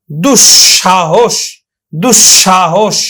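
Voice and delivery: slow দুঃসাহস with lengthened dush-sha-hosh; doubled palatal sh. Shown as a demonstration. Teacher drilling students, slow dush-sha-hosh for দুঃসাহস. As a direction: stretch the sh in the middle of দুঃসাহস. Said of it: "উচ্চারণ করি".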